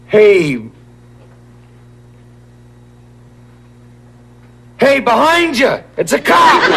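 high-pitched, loud yell of alarm; urgent shout to warn someone behind. Acted. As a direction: shout it full out, voice leaping way up. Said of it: "the other guy shouts".

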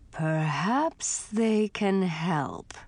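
slow, pointed ghostly grandfather; with an edge. Acted. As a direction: let a threat sit under it. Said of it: "he said with an edge".

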